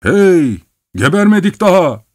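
high, loud indignant call; angry shout. A narrator's reading. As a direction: yell at them in anger. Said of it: "diye bağırdı".

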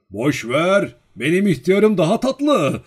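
loud, high-pitched jovial holler; playful shout. A narrator's reading. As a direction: shout it out cheekily over the crowd. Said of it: "diye bağırdı".

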